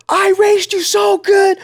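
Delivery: high, loud, slow mock parent wail; defensive boomer parent. Boomer parent answers the boundary talk, high, loud and slow. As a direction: ham up a wounded parent. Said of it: "they go".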